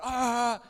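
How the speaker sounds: loud, high straining holler; imitated effort yell. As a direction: yell as if shoving with all your might. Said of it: "You gotta yell".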